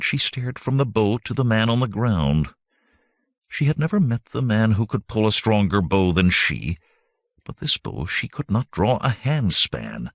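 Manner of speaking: usual speaking voice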